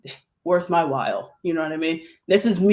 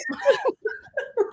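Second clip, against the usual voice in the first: high laugh; 4 dB quieter than usual. Real, not acted. Laughing at old voice bit.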